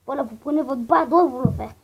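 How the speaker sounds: ordinary talk, nothing marked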